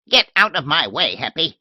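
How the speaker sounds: put-on old lady shooing her maid off, tart and loud; shrill old lady. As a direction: bark it like a cranky old lady. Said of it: "Miss Letitia's shrill old voice".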